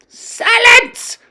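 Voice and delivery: high, loud witch roar; commanding shout. A narrator's reading. Grand High Witch silencing witches, loud shout. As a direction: crack it like a whip. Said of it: "shouted the Grand High Witch".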